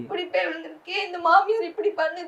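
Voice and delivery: retelling her mother's tearful plea, rising to a pained cry at the end; mother's weeping plea. Retold or imitated. Daughter retells mother's lament at the door, tearful. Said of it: "எப்படி அழுதாங்க தெரியுமா?".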